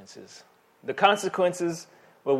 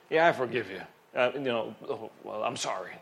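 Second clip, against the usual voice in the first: grudging dismissive apology; 5 semitones lower, 5 dB quieter, faster than usual. Retold or imitated. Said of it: "with that kind of attitude".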